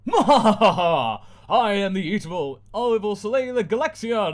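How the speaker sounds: slow loud gloating intro with a laugh; evil villain. Acted. Villain announcing herself, slow and gloating. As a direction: draw out evil like a cartoon villain.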